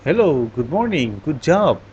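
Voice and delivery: lightly acted English greeting, swooping pitch; Westerner's cheery greeting. Teacher showing students a Westerner's expressive intonation, lightly acted. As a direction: stretch the words with lilting, exaggerated intonation. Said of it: "bule bilang".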